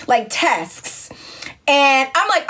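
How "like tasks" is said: "tasks" with S-K-S all sounded; corrected pronunciation. Retold or imitated. Producers repeating it for the host, "tasks" enunciated. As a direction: land every consonant of "tasks". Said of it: "we need you to say tasks".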